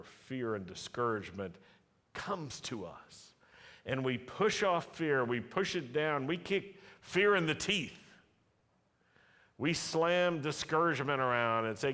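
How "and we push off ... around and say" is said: louder and higher, pushing hard; forceful preaching. Real, not acted. Preacher urges the church to kick fear, loud and higher. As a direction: drive it home with defiant force.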